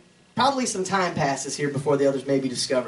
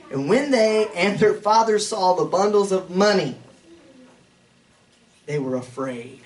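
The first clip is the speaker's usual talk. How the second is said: scripture reading; 4 semitones higher, 3 dB louder than usual. Acted. Reads scripture aloud in sermon.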